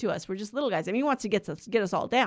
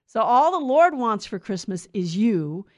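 normal talking voice

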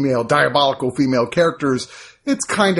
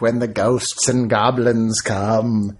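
slow, spooky horror host drawl; Vincent Price impression; 2 semitones lower, slower than usual. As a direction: play a hammy horror host. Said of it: "the worst Vincent Price impression of all time".

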